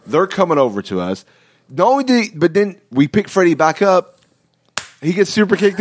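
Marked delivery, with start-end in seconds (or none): none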